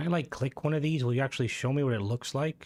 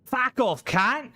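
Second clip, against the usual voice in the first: mock Australian accent; 10 semitones higher than usual. Streamer overdoing it for a viewer who says he sounds Australian, mock Australian accent. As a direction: shout it like a cartoon Aussie, pitched way up. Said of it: "You sound a bit Australian".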